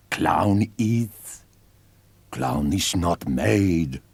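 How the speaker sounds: slow, broken-English clown voice, fading at the end; eerie clown. Acted. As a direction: intone it like an ancient creature's creed. Blue clown explains, broken English.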